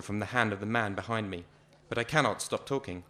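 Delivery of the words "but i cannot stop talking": ordinary delivery, nothing marked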